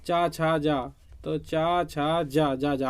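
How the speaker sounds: slow, sound out each syllable; slow syllable drill. Shown as a demonstration. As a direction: count off the palatals one by one, slowly. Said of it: "चा वर्ग के देख लो कौन सा". (धीरे-धीरे अक्षर गिनाते हुए)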